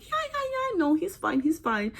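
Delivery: very high, softened mock agreement; brave front. Mother reassuring family while hiding tears, acted brave front. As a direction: squeak out agreement as if holding it together.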